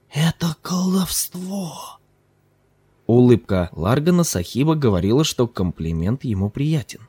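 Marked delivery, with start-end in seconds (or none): 0.1-2.0 slow, awed gasp of disbelief; stunned, wide-eyed wonder; utter amazement. A narrator's reading. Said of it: "в крайнем изумлении".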